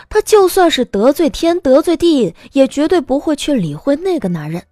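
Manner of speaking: ordinary talk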